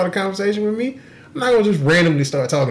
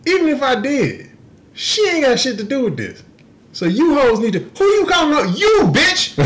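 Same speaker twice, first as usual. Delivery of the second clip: rising pitch, ends in a shout; retold comeback to the ex, ending in a shout; heated callout; 10 semitones higher than usual. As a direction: build to yelling at her. Retold confrontation with ex.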